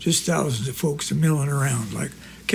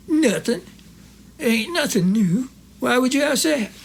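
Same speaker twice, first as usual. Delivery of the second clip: higher, lightly voiced old country friend; Corky's voice; 7 semitones higher than usual. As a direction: play the innocent old pal.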